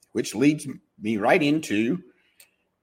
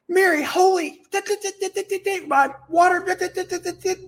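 panicked customer. Acted.

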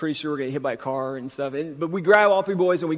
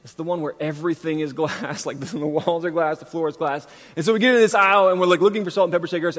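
ordinary talk, nothing marked

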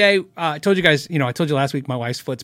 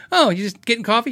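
higher-pitched imitation of a barista; imitated server greeting, pitched up; coffee shop worker; 7 semitones higher than usual. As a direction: put on a friendly barista's small-talk voice. Replays coffee shop worker's question.

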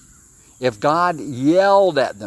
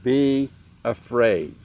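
slow reassurance; much slower than usual. Acted. Elisha calms the servant.

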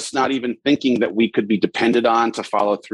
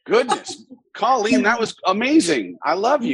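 pitched way up, bubbling with delight; gushing delight; 11 semitones higher than usual. Real, not acted. Host gushing thanks to a tearful fan, high and delighted. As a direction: gush your thanks like you're overwhelmed. Gushing after a heartfelt tribute.

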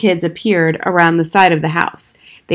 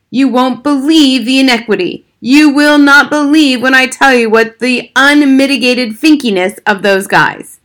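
loud, higher indignant outburst; angry father; 6 semitones higher, 5 dB louder than usual. Acted. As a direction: fume at the injustice. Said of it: "He sounded very angry".

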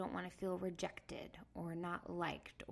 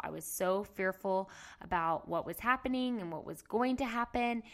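ordinary delivery

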